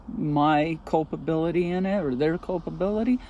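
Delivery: ordinary talk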